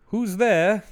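high, loud, polite mock call. Imitating the husband checking the barn, polite calling out. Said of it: "They're so polite about it".

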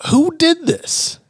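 high, slow, stretched-out shock; a king stunned, dragging out who did this. Retold or imitated. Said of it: "the king's like".

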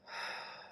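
long soft exhale through the mouth; breathing-out demo. Shown as a demonstration. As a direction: show the out breath. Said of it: "out through your mouth".

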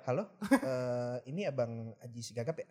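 low, slow mock caller; pretend phone call. (pura-pura menelepon, pelan dan rendah)

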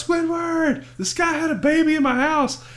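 high-pitched excited cartoon-character impression; SpongeBob. SpongeBob runs to tell his neighbor about the baby, high-pitched impression. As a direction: imitate a cartoon sponge calling out, voice way up. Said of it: "SpongeBob's in his house".

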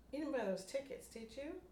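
fishing for a confession; lightly voiced suspicious friend. Rachel suspicious question.

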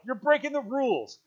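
high, loud accusing shout. A narrator's reading. Ralph accuses Jack, shouting high. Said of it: "shouted Ralph".

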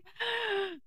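sharp high gasp of surprise; surprised gasp. Real, not acted. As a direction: gasp at the reveal. Reacting to the picked title.

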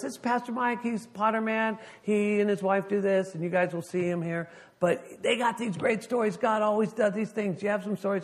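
high-pitched, gushing host; excited introduction. Retold or imitated. Introducing the touring pastor to students, excited. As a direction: talk up the guest in a high, eager voice. Acted introduction to the college class.

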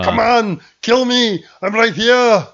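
hammed-up action-hero yell; mock taunt. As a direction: goad the monster to attack. Voicing Arnie taunting Predator.

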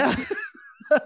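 very high laughing yes; laughing agreement. Real, not acted. Founder agreeing with host's joke, laughing. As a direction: agree while cracking up.

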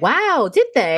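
high, loud feigned astonishment; playful put-on wow; mock surprise. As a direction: act amazed at the obvious, hammed-up. Mock surprise at stated historical fact.

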